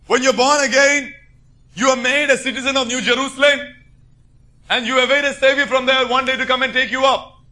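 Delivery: high-pitched, emphatic preacher's voice; forceful preaching. Real, not acted. Preacher telling born-again believers who they are, forceful preaching. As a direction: proclaim it to the congregation with conviction.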